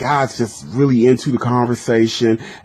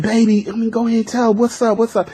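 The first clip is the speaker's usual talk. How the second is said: much higher, urgent pleading; eager caller; 9 semitones higher, 3 dB quieter, faster than usual. Retold or imitated. Lovesick caller begging the girl to tell him, eager and much higher. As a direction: press her anxiously to spill it. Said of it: "he be like".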